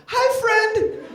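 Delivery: put-on very high, loud little boy call; gleeful kid greeting. Son calling to kids out the window, high and gleeful. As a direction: squeal a hello like an eager child.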